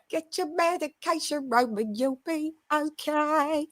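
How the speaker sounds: high, soft mock British voice. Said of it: "Shouts out to [name] for the British accent".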